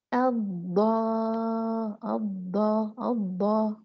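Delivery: अब्बा slow, sounded with care; correct letter. Shown as a demonstration. Teacher models the correct sound slowly. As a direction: say अब्बा deliberately, letter clear. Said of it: "कैसे पढ़ेंगे इसको".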